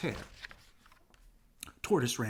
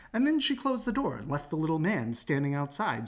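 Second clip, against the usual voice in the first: ordinary delivery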